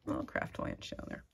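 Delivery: hushed whisper, as if hiding a secret. Real, not acted. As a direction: whisper it like a guilty aside. Hiding craft stash from camera.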